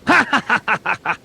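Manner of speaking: high, mechanical staccato villain laugh; artificial intelligence laugh. Acted. Game master's AI villain laughs at players, high staccato robotic laugh. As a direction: laugh like an AI, in evenly chopped bursts. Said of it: "l'intelligence artificielle, c'est ah, ah".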